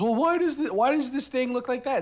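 high, nasal-free whine; mock a nitpicking complainer; whiny mock question. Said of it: "when someone says".

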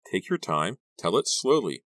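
ordinary delivery

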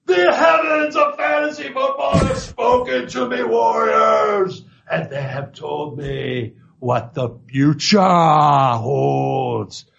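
booming wrestler battle cry; Ultimate Warrior voice. Retold or imitated. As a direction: roar it like a pro wrestler promo. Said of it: "in the Ultimate Warrior voice".

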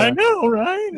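very high squeaky mock reply; squeaky agreement. Agreeing with his crying daughter in a squeaky high voice. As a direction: squeak it out while laughing. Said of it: "And I was like".

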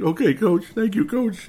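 high, excited thank-you; emotional player quote. Retold or imitated. Draft pick thanking the coach on the phone, high and excited. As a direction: mimic a thrilled rookie thanking his coach. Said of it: "He's like".

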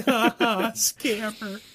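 high-pitched mock laugh and taunt; mocking jeer. Streamer taunting a caught scammer, high mocking jeer.

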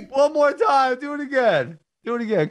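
high, eager repeated pleas; can't get enough; excited begging. Real, not acted. Laughing begging replay.

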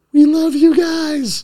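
high, slow, loud mock adoration; exaggerated sing-out of public love; mock gushing. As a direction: imitate a fawning crowd, drawn out way up high. Mimics gushing public after nine eleven.

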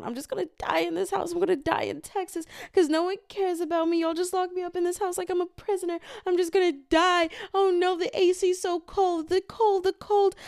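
high whiny complaining grandma voice; grumpy old grandmother. Retold or imitated. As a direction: whine like a grumpy old woman who is sure nobody cares. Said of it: "she's literally told everyone".

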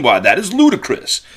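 a hammed-up Southern lawyer's outrage; Southern drawl. Lawyer to the judge, in a hammed-up Southern drawl. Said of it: "maybe a foghorn leghorn".

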